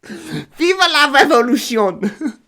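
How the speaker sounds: put-on high, loud, drawn-out French accent shout; hammed-up French revolutionary cry; mock French rallying cry. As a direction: proclaim it slowly in a joke French accent.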